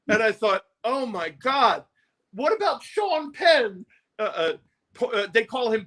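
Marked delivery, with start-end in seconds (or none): 0.8-3.8 slow, rising disbelief; mock horror. Singer horrified at the lies, slow and rising. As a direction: think it in slow hammed-up dread.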